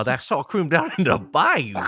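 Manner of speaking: slow, lightly put-on Cajun accent; a hint of a bayou drawl, taken slowly; mock Cajun accent. Describing Cajun sour cream to co-hosts, in a light mock Cajun accent. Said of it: "that wasn't a Cajun accent".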